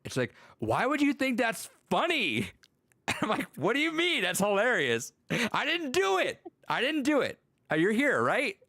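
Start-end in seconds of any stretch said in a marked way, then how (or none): 0.6-2.5 high, drawn-out squeal of protest; exaggerated high-pitched disbelief; mock indignation. Student's indignant reply, acted out. 3.6-8.6 very high-pitched, hammed-up protest; retold excuse, voice pitched way up; mock defensiveness. Acts out his defensive comeback.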